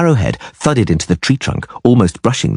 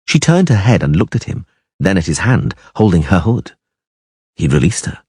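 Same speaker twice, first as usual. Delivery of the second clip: plain reading voice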